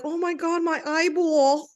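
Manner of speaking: a teasing take-off of a New York mother stretching out eyeball; New York accent. Retold or imitated. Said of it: "my mom's from New York".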